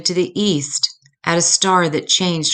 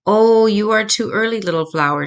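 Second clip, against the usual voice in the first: tender to a flower; 5 semitones higher, 4 dB louder than usual. Acted. Mama speaks to tiny flower.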